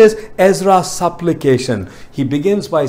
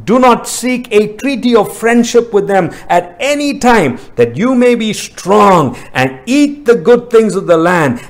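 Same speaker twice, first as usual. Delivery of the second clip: raised, ringing pitch on the verses; emphatic scripture reading; 6 semitones higher than usual. Acted. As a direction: declaim the commands to a congregation. Reads scripture aloud in sermon.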